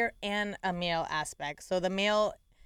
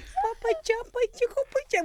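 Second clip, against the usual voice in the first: very high cooing pet talk; baby talk; 14 semitones higher than usual. Retold or imitated. Owner calling her babies in their own language, high cooing. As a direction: coo it way up high to the pets. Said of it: "We'll make our own language".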